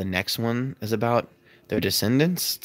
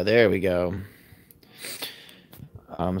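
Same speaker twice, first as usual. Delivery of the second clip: ordinary delivery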